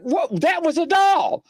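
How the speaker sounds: very high, incredulous imitation of a friend; high-pitched mock disbelief; retold astonishment. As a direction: act out the shocked caller. Retelling caller's shocked reaction.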